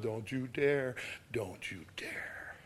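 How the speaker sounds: slow, whispered "don't you dare"; whispered warning. Acted. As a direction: hush him with a stern whisper. God warns Balaam.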